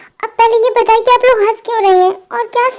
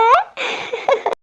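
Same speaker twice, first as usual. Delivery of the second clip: high, soft giggle; 7 semitones higher, 6 dB quieter than usual. Acted. Family laughs at the couple.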